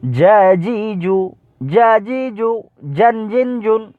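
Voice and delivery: high chanted "জ্যা জি জু", hard j; "জ্যান জিন জুন" recited high with a hard initial j; firm jim sound. Shown as a demonstration. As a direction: press a firm j into each of "জ্যা জি জু". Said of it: "একটু শক্ত হয় আওয়াজটা".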